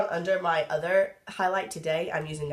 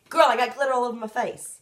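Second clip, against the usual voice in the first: put-on sassy girlfriend voice; 6 semitones higher, 7 dB louder than usual. Quoting an over-glittery look.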